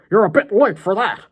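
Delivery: loud, high put-on madman cry; hammed-up deranged threat; menacing villain. A narrator's reading. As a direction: shout it as you charge. Villain charges at the boy.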